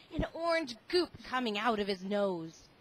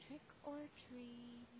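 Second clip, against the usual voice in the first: barely audible, tiny voice; very softly; 21 dB quieter than usual. Acted. Lance asks the fallen man for candy, very softly. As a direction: say it so quietly no one hears. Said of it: "So he said very softly".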